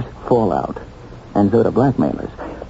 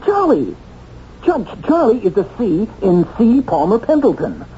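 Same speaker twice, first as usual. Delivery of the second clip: excited discovery; 7 semitones higher than usual. Acted. Piecing together the clue excitedly.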